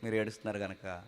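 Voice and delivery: soft, low gentle answer; God gently telling his people why he weeps; tender reply of God. Retold or imitated. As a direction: say it tenderly, almost weeping. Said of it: "అంటాడు".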